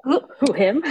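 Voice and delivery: high, bewildered mock "who, him?"; startled reply. Acting student caught off guard by teacher, high bewildered retold reply.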